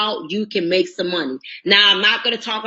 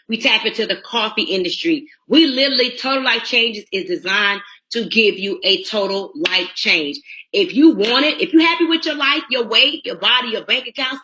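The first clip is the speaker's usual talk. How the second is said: usual speaking voice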